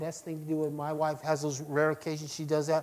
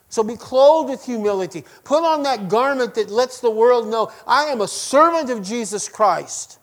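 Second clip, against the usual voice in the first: loud, higher preacher's exhortation; preaching at full force; 5 semitones higher, 8 dB louder than usual. Acted. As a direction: proclaim it to the whole church.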